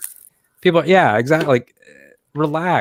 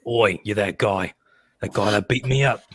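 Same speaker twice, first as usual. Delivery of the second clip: Jason Statham; 4 semitones lower, 4 dB quieter than usual. Acted. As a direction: play an English action-star heavy. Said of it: "sound like Jason Statham".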